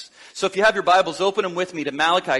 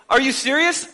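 incredulous second take, more exasperated; raised exasperated repeat; exasperated disbelief; 6 semitones higher than usual. Retold or imitated. God repeats, more insistent.

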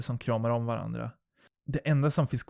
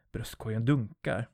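ordinary delivery, nothing marked

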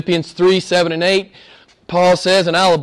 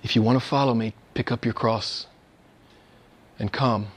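hushed solemn quote; 8 semitones lower, 8 dB quieter than usual. Acted. Quoting Jesus' real call.

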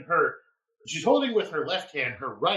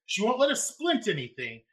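ordinary talk, nothing marked

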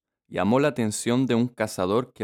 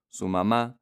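usual speaking voice

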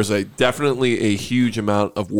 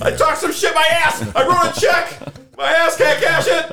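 high, slow mocking voice. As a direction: squeak it out, teasing yourself. High mocking self-quote.

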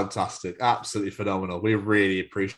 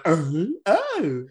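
higher-pitched mock dawning "oh"; delayed realisation; 6 semitones higher than usual. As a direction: act out the penny dropping late. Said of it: "so my brain went".

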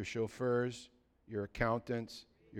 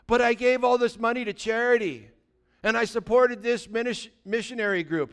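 higher, louder protest; defensive giver; 9 semitones higher, 8 dB louder than usual. Acted. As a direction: justify yourself, offended.

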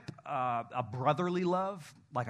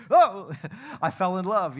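a much louder, higher gushing Oh; mock swooning teen; 4 semitones higher, 10 dB louder than usual. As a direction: ham up a lovestruck teenager.

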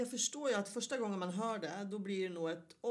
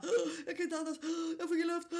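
hammed-up breathless panic, higher and louder; fake gasping; 8 semitones higher, 6 dB louder, faster than usual. [name] faking breathlessness to scare her mother, with a laugh. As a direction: clutch your chest and fake it, laughing.

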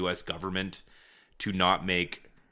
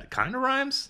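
sing-song squeak. Pretend. Sing-song rhyme remark.

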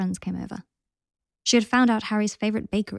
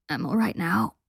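wheezing. A narrator's reading. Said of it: "wheezed Lucius".

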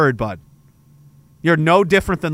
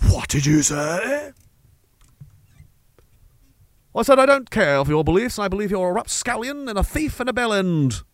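put-on old-timey gentleman; 5 semitones higher than usual. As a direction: declaim a duel challenge grandly. Acted old-time duel dialogue.

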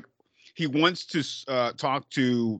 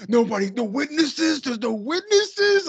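very high, slow mock cop whine; mocking actor quote; 12 semitones higher, 3 dB louder, slower than usual. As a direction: drag it out in a squeaky parody. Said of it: "It's like".

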